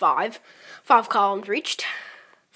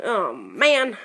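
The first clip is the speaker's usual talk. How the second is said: ordinary talk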